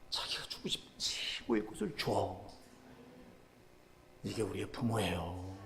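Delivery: soft, low whispered preaching; hushed whisper. Acted. Preacher whispering to the congregation about parents' love. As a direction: draw them in with a whisper. Sudden hush.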